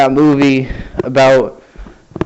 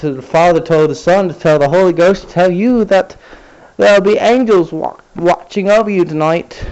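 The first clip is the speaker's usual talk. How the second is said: high sing-song mock piety, higher pitch; mock sweet read; 6 semitones higher than usual. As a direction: read it like a syrupy greeting card. Mocking the saccharine religious comment.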